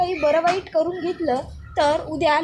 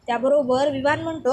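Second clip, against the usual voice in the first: plain reading voice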